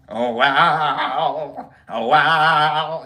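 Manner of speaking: loud, warbling, stretched-out impression; Carol Channing. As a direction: wobble the vowels in a bad impression. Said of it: "You're like a Carol Channing".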